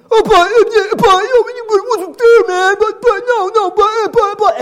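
high-pitched, loud mock whining; hammed-up stammering protest in a squeaky voice; sputtering excuse-maker. Defendant making excuses to the judge, mock sputtering and high. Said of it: "You know what that idiot did?".

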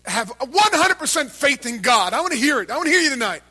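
loud, high preacher shout that pushes the crowd to answer; full-force preaching. Acted. Preacher rousing the congregation to shout back, loud full-force preaching. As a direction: drive it home like a revival preacher.

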